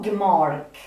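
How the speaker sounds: ordinary talk, nothing marked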